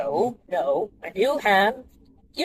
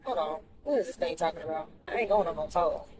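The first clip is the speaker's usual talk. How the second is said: "I ain't going on no toll" grumbled lower and softer; muttered refusal; 3 semitones lower, 10 dB quieter than usual. Real, not acted. Driver repeating her refusal to the GPS: muttered, softer. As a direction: grumble it under your breath.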